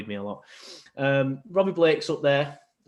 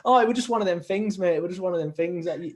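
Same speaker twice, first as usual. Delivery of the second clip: high, loud retold protest; raised-pitch quote of an embarrassed excuse; Joey Barton; 6 semitones higher, 3 dB louder than usual. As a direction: lightly imitate the player's defensive reply. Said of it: "he just turned around and he was like".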